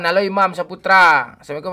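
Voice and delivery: normal talking voice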